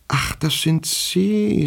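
very high, drawn-out oh-it's-you; feigned recognition. A narrator's reading. Younger Goldjetkin pretends to recognize the elder, feigned surprise. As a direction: stretch it out high, as if only just noticing. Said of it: "als hätte er erst jetzt Herrn Goldjetkin den Älteren erkannt".

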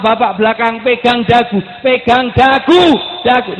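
high, very loud repeated order; insistent full-voice yelling; barked command. Real, not acted. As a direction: shout the instruction over and over at the crowd. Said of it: "sampai saya bentak-bentak".

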